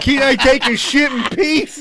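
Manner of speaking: mock outraged plea; exasperated yell. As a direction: plead for peace. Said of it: "I'm like".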